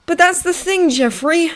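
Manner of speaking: high-pitched, mild moan; moaning complaint. A narrator's reading. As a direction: complain plaintively to an old confidant. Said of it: "moaned Hiccup as a frown replaced".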